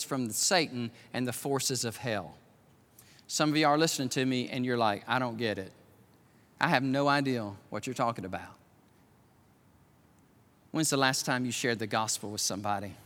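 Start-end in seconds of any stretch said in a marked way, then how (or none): 3.3-12.9 low, softened preacher's voice; hushed, searching preaching. Acted. Preacher questioning church about sharing the gospel, hushed. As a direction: drop low and put it to them personally.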